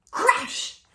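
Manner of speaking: high sharp crash; sudden crash!; dramatic crash. Acted. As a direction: pop it like a sound effect. Sound effect in story.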